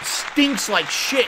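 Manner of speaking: slow loud drawl; drawn-out loud shout. Acted. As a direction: drag it out, loud. Repeated shouted line.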